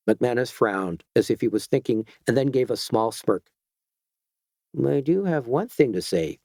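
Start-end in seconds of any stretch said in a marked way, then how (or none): none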